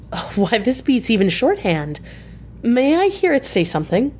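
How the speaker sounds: rising eagerly, a bright rush of words; excited blurt. A narrator's reading. Mina to Seward, delighted by the phonograph, excited. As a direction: blurt it out, thrilled by the machine. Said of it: "I felt quite excited over it and blurted out".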